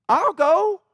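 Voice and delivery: high, bright-eyed offer; eager volunteering. Retold or imitated. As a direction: jump at the chance.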